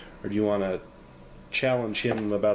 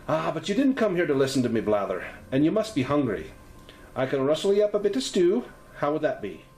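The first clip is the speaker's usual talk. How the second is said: higher, fussing Irish landlady; Irish lilt; 6 semitones higher, 3 dB louder than usual. Acted. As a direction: offer stew in a light Irish lilt. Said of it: "Her voice has a slight Irish lilt".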